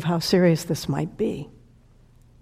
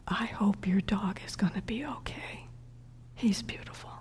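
much softer, whispered wish; a grieving stranger whispering her blessing; whispered kindness; 13 dB quieter than usual. Retold or imitated. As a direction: hush it, tender and private. Grieving woman's whispered wish retold.

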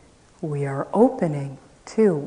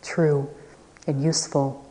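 usual speaking voice

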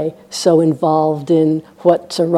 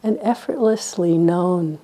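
soft, unhurried meditation voice with long silences; guided meditation; 4 dB quieter than usual. Real, not acted. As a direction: quietly guide listeners to rest in hearing.

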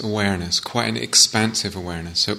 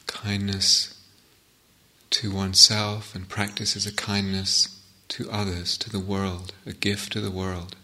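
guided meditation. Acted. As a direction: ease them into the sitting, unhurried.

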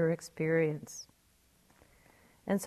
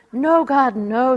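a high, loud, urgent no; shouted protest; 6 semitones higher, 11 dB louder than usual. Retold or imitated. Atheist shouts her protest up to God. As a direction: shout back to the sky to stop him. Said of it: "she shouted out".

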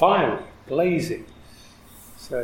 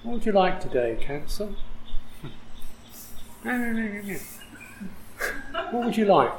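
playful questioning; 6 semitones higher, 4 dB quieter than usual. Pretend. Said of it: "You talk directly to this kind of nagging voice".

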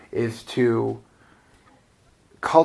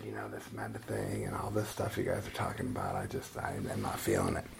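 much softer and lower, owning up; sheepish, much softer confession to her teacher; quiet confession; 13 semitones lower, 14 dB quieter than usual. Retold or imitated. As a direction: admit it to the teacher under your breath. Confessing doubts to meditation teacher.

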